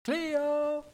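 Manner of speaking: high, sustained search call; parents' drawn-out cry for their child. Retold or imitated. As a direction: call the name long and high. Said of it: "begynner å lete og begynner å rope".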